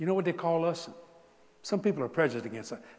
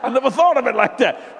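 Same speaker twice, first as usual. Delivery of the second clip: friend laughing; 8 semitones higher, 11 dB louder than usual. Retold or imitated. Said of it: "Like he".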